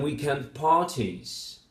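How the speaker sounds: "weekend parties" with main stress on parties; slow weekend parties, leaning on "parties"; stress shift. Shown as a demonstration. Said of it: "primary stress shifts to the word parties".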